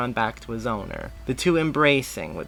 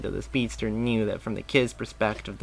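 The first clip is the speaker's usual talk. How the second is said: usual speaking voice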